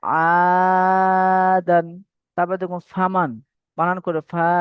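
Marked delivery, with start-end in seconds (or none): 0.0-1.9 আদান held long and slow, each vowel stretched; drawn-out recitation. Shown as a demonstration. Teacher showing students how to pronounce আদান, slowly chanted. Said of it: "তারপর বলো যেমন".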